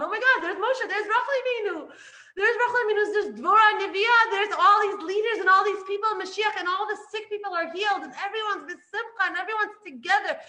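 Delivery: picture the redemption and light up; excited vision. Real, not acted. Teacher to listeners, picturing Mashiach arriving, thrilled and laughing.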